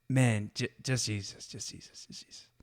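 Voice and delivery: "just Jesus" repeated, dropping to a whisper; fading to a whisper. Shown as a demonstration. Showing listeners a second way, fading to a whisper. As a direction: let each "just Jesus" fade until only breath remains. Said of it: "three amazing ways".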